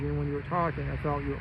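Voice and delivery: ordinary delivery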